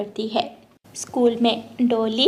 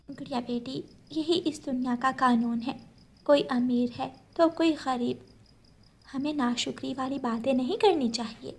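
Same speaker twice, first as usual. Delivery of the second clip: soft, tender motherly voice; calm her child down softly; gentle mother; 9 dB quieter than usual. Acted. Mother gently explaining to her daughter, soft and tender.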